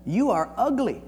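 mock exasperated comeback with pauses; fed up. Churchill fed up with the woman, pointed retort. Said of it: "he had it up to here".

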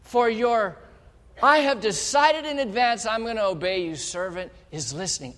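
put-on boy Samuel vowing obedience, eager and earnest; earnest pledge. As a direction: swear obedience with fervor. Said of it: "Samuel goes".

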